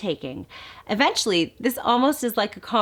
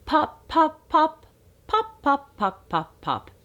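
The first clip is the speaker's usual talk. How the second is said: high pops falling back to usual pitch; playful popping again; 8 semitones higher, slower than usual. Shown as a demonstration. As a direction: pop pop with a changing tune. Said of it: "Say it again".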